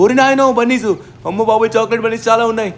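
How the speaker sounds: high, loud yelp at the swarm, then fast; alarmed surprise. Real, not acted. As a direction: caught off guard by a horde. Sudden swarm of enemies. (ఆశ్చర్యంతో భయంగా కేక)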